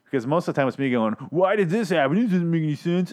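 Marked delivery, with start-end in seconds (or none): 1.3-3.1 high, pinched nerdy complaint; mock yourself as a nitpicking fanboy; whining internet nerd. Critic mocking his own complaints, whiny nerd voice. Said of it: "I feel like an internet nerd".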